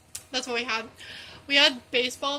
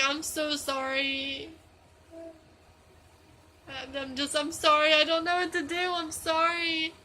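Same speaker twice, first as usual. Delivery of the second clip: pleading apology; 3 semitones higher than usual. Retold or imitated. Prankster apologizing to the fooled friend, pleading.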